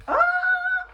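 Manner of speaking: sudden high yelp; startled scream. Real, not acted. Reader startled by a card during shuffle, high yelp. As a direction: shriek as the cards fly. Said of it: "casually screaming".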